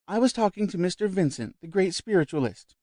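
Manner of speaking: high, eager society lady; lightly voiced effusive visitor; bright chatty lady at dinner; gushing guest. A narrator's reading.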